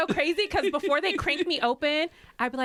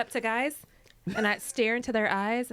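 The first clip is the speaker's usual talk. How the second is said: ordinary talk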